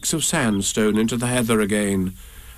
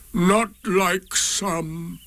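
dragging, higher donkey voice; slow gloomy donkey; 6 semitones higher, much slower than usual. A narrator's reading. Eeyore thanks Pooh, slow and mournful.

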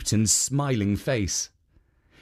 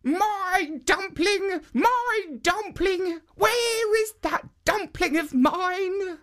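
put-on very high, slow calling old woman; old woman crying out; slower than usual. A narrator's reading. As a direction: wail after the dumpling in a high old voice. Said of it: "still crying out".

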